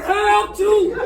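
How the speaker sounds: loud mimicked cry for help; hollering. Girl imitating her attacker begging release, hollering. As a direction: holler it out like the man in pain. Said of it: "And he was hollering".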